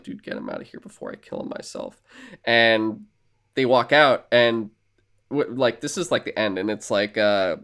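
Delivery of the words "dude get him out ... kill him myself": much lower and softer, barely voiced; retold low mutter. Han to friend about the patient, muttered low. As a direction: quote it under the breath, very low. Retold aside in hospital room.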